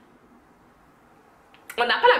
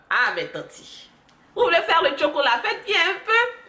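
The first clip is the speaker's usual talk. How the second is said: mock scolding; 7 semitones higher than usual. Again addressing bleached aunty.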